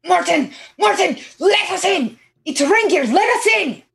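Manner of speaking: urgent high strained call, held back; whisper screaming. Acted. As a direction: plead at the door in a hushed scream. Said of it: "whisper screaming for".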